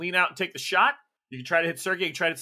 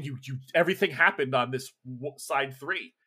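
ordinary delivery

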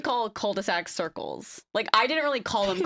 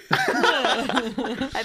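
laughing and trailing; 3 semitones higher, 4 dB louder than usual. Real, not acted. Laughing, lost her thought.

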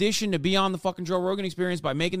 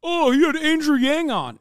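higher, sarcastic mock quote; 9 semitones higher, 4 dB louder than usual. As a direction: parody their dumb argument. Mocking Rogan fans' claim.

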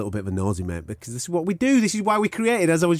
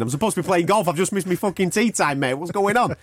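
higher-pitched, put-upon grumble; mock complaint; 6 semitones higher than usual. Boxer moaning about his missed golf, mock complaint. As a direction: imitate a mate protesting the hold-up. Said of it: "Even Bellew complained".

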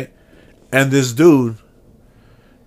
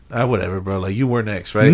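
dismissive concession; 6 semitones lower than usual. Pretend. Imagining letting the other shopper go ahead, low and dismissive. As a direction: let it go in a lower, indifferent voice.

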